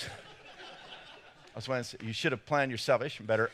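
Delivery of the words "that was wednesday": words breaking into breathy laughter; real laughter swallowing the words; laughing. Real, not acted. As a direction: laugh it out at your own mistake. Laughs at his own blunder.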